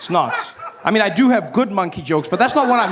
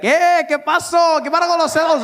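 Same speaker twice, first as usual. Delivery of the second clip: friendly Spanish-speaking guys; 10 semitones higher than usual. Retold or imitated. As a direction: hail him like one of your own. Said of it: "guys come up to me".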